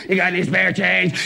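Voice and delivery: slow, robotic voice box ask; voice box beggar. Acted. Panhandler asking for change, robotic. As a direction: rasp it through a voice box.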